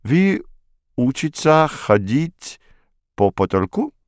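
throaty French r on «учиться ходить по потолку», high and slow; burred French r. Acted. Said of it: "сказал он великолепно картавя".

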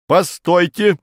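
high, loud interjection; interrupting official. A narrator's reading. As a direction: cut in sharply to halt the report. Farfurkis interrupts the report. (перебивая, высоко и громко)

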